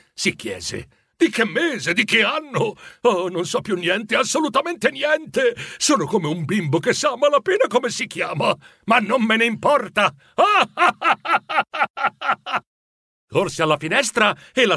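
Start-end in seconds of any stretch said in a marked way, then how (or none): 1.2-10.1 high-pitched, elated self-questioning; giddy excitement. Acted. Scrooge waking up not knowing the date, giddy. 10.4-12.6 high, long peal of staccato laughter; giddy laugh. Acted. Scrooge not caring what day it is, gleeful laughing.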